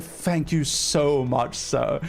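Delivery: slow, drawn-out mock gratitude; bitchy thanks. Passenger pointedly thanks the steward for the man's benefit, bitchy. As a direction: lay the thanks on thick. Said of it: "I was such a little bitch about it".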